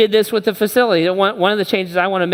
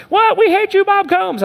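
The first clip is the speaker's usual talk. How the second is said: squeaky high mock outcry from the crowd; high, loud cartoon heckle of an angry congregation; 4 dB louder than usual. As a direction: ham up the outraged churchgoers, pitched way up. Mock congregation outrage at Bob.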